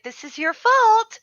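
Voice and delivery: high-pitched mock accusation; retold blaming. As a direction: throw the blame back, pitch jumping up. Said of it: "I'm like".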